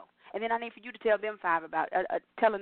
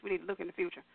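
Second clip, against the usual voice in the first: usual speaking voice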